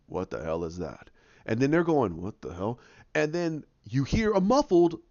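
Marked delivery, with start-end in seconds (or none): 0.1-1.1 deep villain voice, quiet and low; low Thanos voice. Retold or imitated. 2.2-2.8 deep, quiet puzzled voice; low puzzled voice. Retold or imitated. Characters voiced in retelling.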